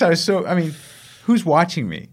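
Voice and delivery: normal talking voice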